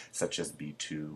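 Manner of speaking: ordinary delivery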